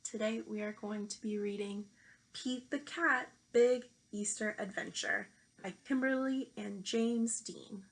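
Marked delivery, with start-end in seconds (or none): none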